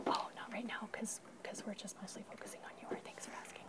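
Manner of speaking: soft unvoiced whisper. Real, not acted. Teacher answering a child's paper question, whispered. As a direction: answer without disturbing the class.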